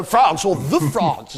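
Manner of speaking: THE in "the France" stretched slowly; mock grand "the France"; stress on THE. Shown as a demonstration. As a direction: lean on "the" in "France, or the France". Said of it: "as it prefers to call itself".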